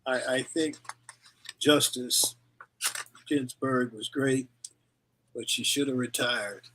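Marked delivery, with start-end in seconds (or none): none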